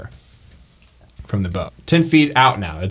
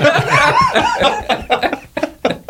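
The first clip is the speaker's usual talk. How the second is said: high cackling laugh. Real, not acted. GM cackling at gory death.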